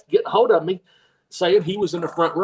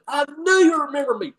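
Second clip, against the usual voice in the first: high, proud fan voice; eager fan; 9 semitones higher, faster than usual. Retold or imitated. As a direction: play the fan beaming at being remembered.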